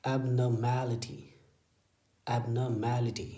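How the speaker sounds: Abnormality spelled out slowly, syllable by syllable; teacher's very slow abnormality; slow model reading. Shown as a demonstration. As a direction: draw out each syllable of abnormality for the class. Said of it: "इसे क्या पढ़ते हैं".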